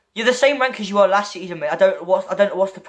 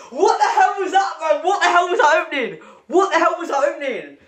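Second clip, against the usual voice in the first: incredulous outburst; 8 semitones higher than usual. Real, not acted. Reacting to a friend's lucky opening, high incredulous yell. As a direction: yell at the screen in disbelief.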